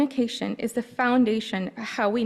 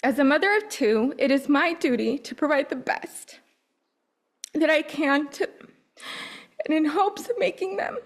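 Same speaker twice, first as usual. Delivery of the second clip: higher, holding back tears; choked up; 4 semitones higher than usual. Real, not acted. Mother speaking about her children, voice choked with emotion. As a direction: push through the words while fighting tears. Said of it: "getting emotional".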